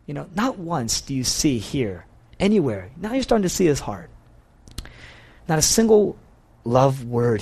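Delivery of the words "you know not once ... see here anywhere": usual speaking voice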